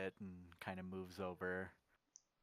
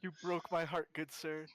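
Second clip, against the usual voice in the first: mock heartbreak; 7 semitones higher, 5 dB louder than usual. Mock heartbreak before a slap.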